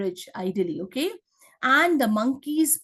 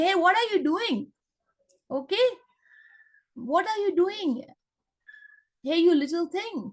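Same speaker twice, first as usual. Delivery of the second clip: high, mock rude shout from a big monkey; 6 semitones higher than usual. Monkey scolding the squirrel in his way, high mock shout. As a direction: bark at the little squirrel like a rude brute. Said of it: "shouted the monkey".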